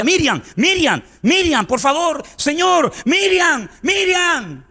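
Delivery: put-on high, desperate cries of a child's name, slow at first; desperate calling. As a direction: call out to her as if she won't wake. Father calling his unconscious daughter. (llamando desesperado a su hija inconsciente, muy agudo)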